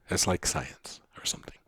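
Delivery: very soft, unvoiced aside; whispered aside. Real, not acted. Teacher undercutting own advice to the class, whispered aside. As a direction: whisper it as a throwaway.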